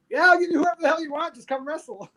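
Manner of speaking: high, quick, hyped-up imitation; eager go-ahead. Booker telling a wrestler to come back, imitated with high eagerness. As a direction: toss out a breezy, high-pitched invitation.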